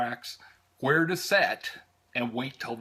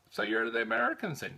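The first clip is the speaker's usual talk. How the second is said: higher-pitched imitation of an Irish conductor's brogue; 9 semitones higher, faster than usual. Conductor greeting American tourists on the train, Irish brogue. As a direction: do the friendly Irish conductor in an Irish accent. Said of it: "lovely brogue that I have".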